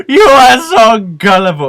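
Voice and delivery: high, loud, slow hammed-up villain cry; imitated game villain. Dying villain taunting his killer, high, loud, drawn-out mock gloat. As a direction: crow it out in mock triumph. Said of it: "And he goes".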